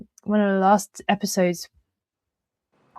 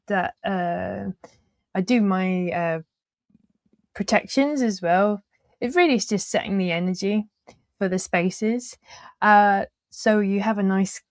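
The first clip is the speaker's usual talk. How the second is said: usual speaking voice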